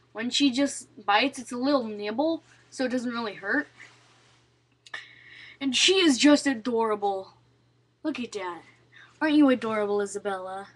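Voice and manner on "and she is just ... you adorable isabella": ordinary talk, nothing marked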